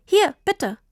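high, eager offer; beaming child offering a gift. A narrator's reading. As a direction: hand it over proudly, pitch lifted. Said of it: "sagte Tini strahlend".

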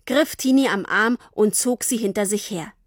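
usual speaking voice

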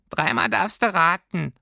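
high little creature voice; squeaky furry creature. A narrator's reading. Creature makes the girl guess who it is, squeaky. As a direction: pipe it pertly.

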